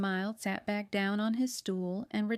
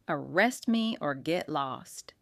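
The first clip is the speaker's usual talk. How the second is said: hateful tone; 5 semitones lower, slower than usual. A narrator's reading. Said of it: "in a hateful tone".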